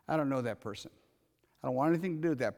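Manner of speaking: low, quiet brush-off stressing I; cold dismissal. Retold or imitated. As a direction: disown someone quietly and flatly. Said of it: "would get you out of a relationship with me".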